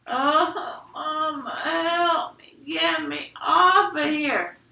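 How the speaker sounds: high, slow, drawn-out plea; pleading wail. Acted. Stuck boy begs Mama to free him, wailing. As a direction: wail through stuck lips.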